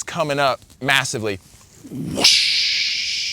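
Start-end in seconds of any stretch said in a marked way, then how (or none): 1.9-3.3 breathy rushing whoosh of a wave; imitated wave whoosh. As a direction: make the sound of water surging up the sand.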